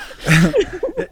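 loud high burst of laughter. Real, not acted. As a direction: crack up loudly.

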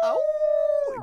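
loud, high wolf howl. Acted. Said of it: "just like that".

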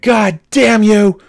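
high, loud road-rage scream; retold screaming. Driver cut off in the drive-thru, hammed-up furious scream. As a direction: re-enact screaming at the driver ahead. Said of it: "I'm just, like, screaming".

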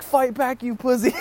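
high mock scream of goading; shrieky egging-on, pitched well above normal; imitated screaming. Girl goading him during the beating, imitated scream. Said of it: "the girl that I'm with is screaming".

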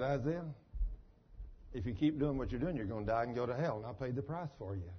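low, hushed divine warning; God's voice. Retold or imitated. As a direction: warn softly and low as the Lord. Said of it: "the Lord speaks to Isaiah in an audible voice".

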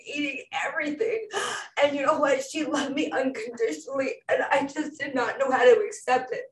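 ordinary talk, nothing marked

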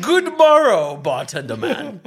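high, loud olde English flourish; hammy stage actor. Pretend. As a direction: ham it up like a Shakespeare player. Mock Shakespearean greeting.

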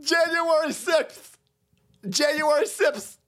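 high, shrieking mock panic; mock hysteria. Mocking a panicked liberal, high hysterical. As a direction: ham up the outrage. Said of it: "You dumb motherfucker said".

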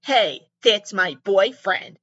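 lightly acted woman staking her claim, slow; caller's protest. Girlfriend phoning the contestant about her boyfriend, mock protest. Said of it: "the woman's like".

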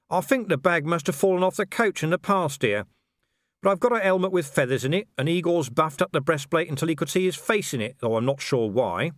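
a husband dodging the regalia; innocent Vimes. A narrator's reading. Vimes excuses himself.

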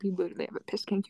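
whispered, quick teasing; fast whisper. Real, not acted. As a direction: hiss it fast under breath. Teasing, repeated.

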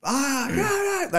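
high yelled calls from across the river; high-pitched distant shout; retold distant yelling. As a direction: yell it like someone shouting from another boat. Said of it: "Everyone's yelling at each other".

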